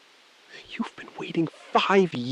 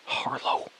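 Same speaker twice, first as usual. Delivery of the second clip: whispered name; 6 dB quieter than usual. Acted. Hushed name in standoff.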